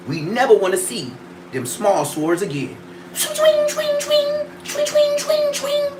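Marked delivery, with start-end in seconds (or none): 3.1-5.9 squeaky high whooshing swings; high swing sword noises. Pretend. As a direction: imitate a sword whooshing past.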